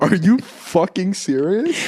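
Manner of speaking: slow, stunned disbelief; drawn-out are you serious. Real, not acted. Disbelief at the math error.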